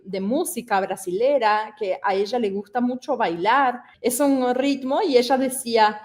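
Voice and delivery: ordinary talk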